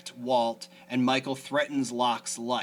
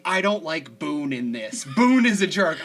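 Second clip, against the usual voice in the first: mock complaint; 4 semitones higher, 9 dB louder than usual. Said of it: "she keeps messaging me".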